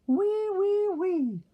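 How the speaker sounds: very high, loud squealing wee; piggy squeal. Acted. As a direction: squeal it like happy piglets. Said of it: "the three little pigs rang".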